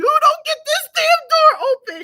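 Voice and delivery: high, loud, half-laughing shout at mom; laughing yell. Retold or imitated. Fighting the door, yelling at her mom while laughing.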